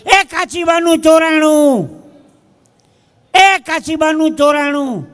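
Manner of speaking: loud, slow, high call down the train; a man calling loudly along the train; shouting out. Retold or imitated. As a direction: bellow it out across the carriage. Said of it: "એક જણા ઓચિતાની બૂમ પાડી".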